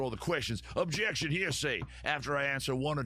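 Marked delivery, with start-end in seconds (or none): 0.8-1.8 Southern lawyer. Acted. The host objects mid-read, in a Southern lawyer accent. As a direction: snap out the objection like a Southern attorney. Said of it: "I'm a Southern, like, lawyer suddenly".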